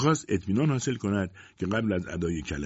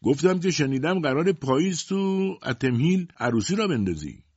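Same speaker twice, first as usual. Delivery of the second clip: higher and louder, repeating it; raised voice; 8 semitones higher, 3 dB louder than usual. A narrator's reading. Shopkeeper repeats the tease to Ted, voice raised. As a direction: push it out so the whole store hears.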